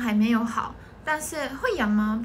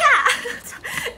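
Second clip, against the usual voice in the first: shrieking laugh; 11 semitones higher, 5 dB louder than usual. Real, not acted. Cracking up during dance story.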